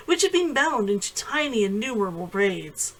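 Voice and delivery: ordinary delivery, nothing marked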